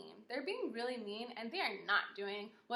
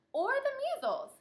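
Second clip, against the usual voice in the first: much higher, louder squeaky doctor voice; 14 semitones higher, 5 dB louder than usual. Acted. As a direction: pipe up in a tiny high voice. Second doctor chimes in.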